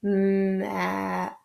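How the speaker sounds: "Map." drawn out to the final p; slow, soft "Map." sound by sound; stretched word. Shown as a demonstration. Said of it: "the third sound in the word map".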